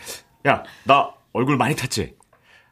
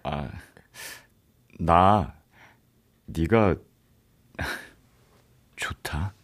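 soft confession; 7 semitones lower, 4 dB quieter, much slower than usual. Acted. Shy love confession.

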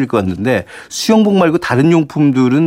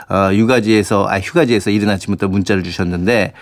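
normal talking voice

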